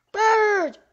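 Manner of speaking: loud high call. Acted. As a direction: shout for a friend across the wood. Squirrel calls out for Bird.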